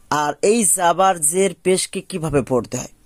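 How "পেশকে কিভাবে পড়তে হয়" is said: ordinary talk, nothing marked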